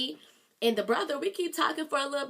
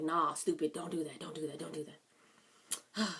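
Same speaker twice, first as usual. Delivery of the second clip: hushed self-scolding; 6 semitones lower, 9 dB quieter than usual. Retold or imitated. Scolding herself while sneaking out.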